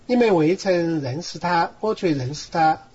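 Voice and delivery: steady verse-by-verse reading with Sichuanese pronunciation and tones; Sichuan dialect. Shown as a demonstration. Bible student reading John for the teacher on request, in Sichuan dialect. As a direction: read scripture aloud in Sichuan dialect. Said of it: "四川话念一念".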